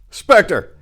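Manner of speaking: triumphant gangster calling his partner; excited outburst. A narrator's reading. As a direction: crow it out in triumph. He laughs, excited discovery.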